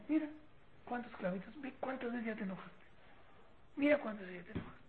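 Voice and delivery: very soft, unscolding observation; gentle father. Acted. Father showing son the nails in the wall, quiet and gentle. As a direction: point it out quietly, without reproach.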